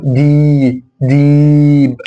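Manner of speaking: ديب with a very long, level ee; long vowel. Shown as a demonstration. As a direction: draw out the vowel of ديب slowly. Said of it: "أطول أكن الياء ممدود". (ديب بياء ممدودة)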